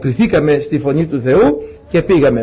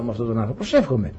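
very soft, low aside to God; private prayer; 7 semitones lower, 9 dB quieter, faster than usual. Retold or imitated. Preacher asking God about his stubborn relative, praying quietly. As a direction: let it sink into a quiet retold prayer.

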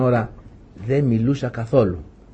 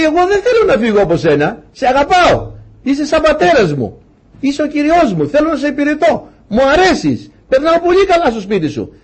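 higher, earnest plea of a servant who won't leave; devoted slave's plea; 11 semitones higher than usual. Retold or imitated. The slave pleads with his master to keep him, voice raised high. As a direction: beg to stay, voice lifted with affection. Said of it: "και του πει".

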